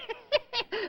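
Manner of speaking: high gleeful witch cackle; gloating witch laugh. Acted. Witch gloats over her trick.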